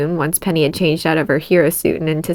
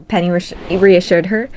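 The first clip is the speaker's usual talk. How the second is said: ordinary delivery, nothing marked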